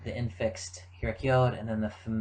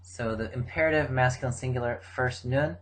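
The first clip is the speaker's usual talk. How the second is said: ordinary talk, nothing marked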